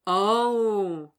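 loud delighted oh; pleased exclaim. Acted. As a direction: exclaim it, far too pleased. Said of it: "Connor exclaims, far too pleased".

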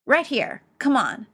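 loud, impatient summons; high, loud, bossy bark; barked order. A narrator's reading. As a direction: order someone over sharply. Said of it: "Ed barked".

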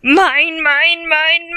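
high, loud greedy shout. Acted. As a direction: shriek it like a spoiled king. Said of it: "Uther shouted again".

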